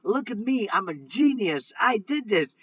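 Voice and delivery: imitating a giddy trader who thinks he's a genius; mock excited gloating. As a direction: crow over a lucky win, half-joking. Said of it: "you get all excited and you're like".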